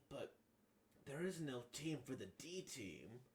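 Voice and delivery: normal talking voice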